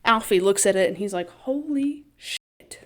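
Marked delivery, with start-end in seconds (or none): 1.3-2.8 slow, higher, stunned; a mock gasp of amazement; awed. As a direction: let the awe stretch the words out. Alfie stunned by the Fabergé egg.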